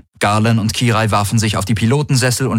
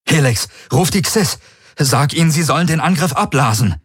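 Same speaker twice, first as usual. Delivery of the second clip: raised, pressing command; urgent order; 7 semitones higher than usual. A narrator's reading. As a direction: give a frantic order, pitched up. Urgent order amid space battle. (dringender Befehl mit erhobener Stimme)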